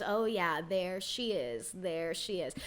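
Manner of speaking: low, hushed, slow taunt; smug, hushed mock taunt. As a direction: goad her quietly and smugly. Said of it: "and Dorit goes".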